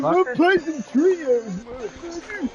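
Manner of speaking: put-on high, slow croaky old geezer; stupid old man voice. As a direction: drag it out like a cranky grandpa. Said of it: "Sound like a stupid old man".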